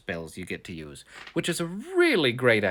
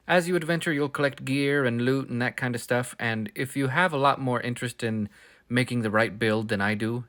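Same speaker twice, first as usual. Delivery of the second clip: ordinary talk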